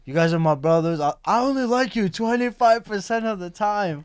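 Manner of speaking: a hint of a whiny buddy; mocking friend's voice. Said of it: "he's called us, like, his brothers".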